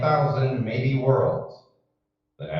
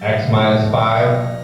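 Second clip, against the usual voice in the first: loud, measured launch countdown; countdown announcer; 4 dB louder than usual. Acted. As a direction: call the countdown like mission control.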